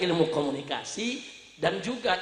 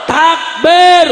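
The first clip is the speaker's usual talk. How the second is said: high, very loud rallying cry; takbir call; 9 semitones higher, 16 dB louder than usual. Real, not acted. Preacher summons the congregation's takbir, full shout. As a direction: shout to lead the crowd's response.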